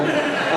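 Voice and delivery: high burst of laughter. Real, not acted. Telling a camp story, bursting into laughter.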